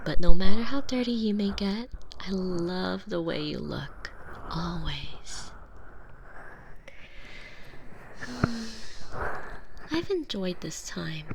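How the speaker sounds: intimate unvoiced whisper, close in. Girlfriend whispering while washing her captive partner. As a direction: whisper each word as you wash him.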